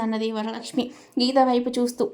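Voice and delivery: ordinary delivery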